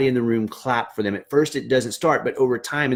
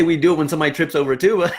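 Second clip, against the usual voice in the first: laughing; 5 semitones higher, much faster than usual. Real, not acted. Joking to the band students.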